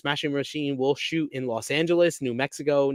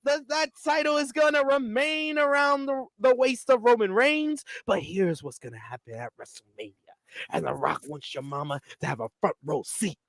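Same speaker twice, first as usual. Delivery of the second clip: Rock promo impression; 10 semitones higher than usual. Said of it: "he says".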